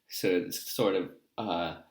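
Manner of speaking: normal talking voice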